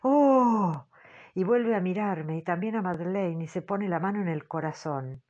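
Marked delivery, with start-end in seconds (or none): 0.0-0.9 un "¡Oh!" agudo y muy fuerte, espantado; grito de sobresalto. A narrator's reading. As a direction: exclama horrorizada al ver la escena. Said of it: "Entonces grita". (grito de espanto de la señora Brink)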